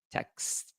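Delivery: "Text" as a single syllable; quiet, isolated "Text"; one-syllable pronunciation. Shown as a demonstration. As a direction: say "Text" with no extra vowel. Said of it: "There's no Texas".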